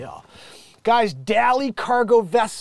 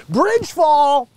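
high mock gasping cry, louder; mock gasp and shout; 8 semitones higher, 8 dB louder than usual. Witness on the bridge gasping as it falls, mock shout. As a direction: act out a shocked gasp then yell. Imitating crash sound heard.

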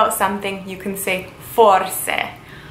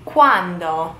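normal talking voice

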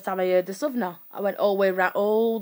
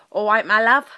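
loud London market-trader greeting; Southern English accent; 2 semitones higher, 10 dB louder than usual. Acted. Vlogger imitating how a Southerner talks, loud London accent. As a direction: call it out like a Londoner. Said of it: "A Southerner person that talks like".

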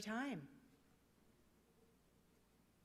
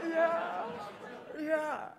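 laughing it off; 7 semitones higher, 7 dB louder than usual. Real, not acted. Laughing it off after swearing.

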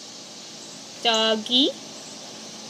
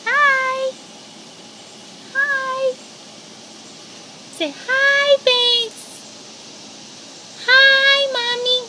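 put-on sing-song baby talk; 10 semitones higher, slower than usual. Greeting baby on video call.

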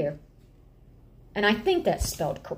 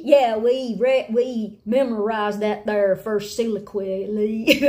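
slow hammed Appalachian drawl; Appalachian accent; slower than usual. Shown as a demonstration. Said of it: "Appalachian people can't talk".

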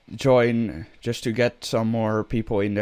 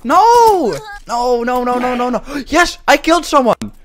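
frantic yelling; 10 semitones higher, 6 dB louder than usual. Real, not acted. Frantic yelling during the game.

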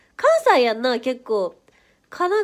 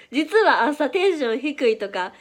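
ordinary talk, nothing marked

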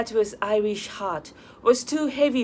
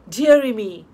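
louder, startled little cry; surprised exclamation; 9 dB louder than usual. A narrator's reading. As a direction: burst out in mild surprise. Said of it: "exclaimed the young person".

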